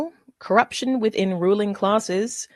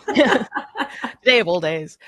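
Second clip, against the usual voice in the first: laughing, drawn out; 4 dB louder, much slower than usual. Real, not acted. Laughing joke with the table.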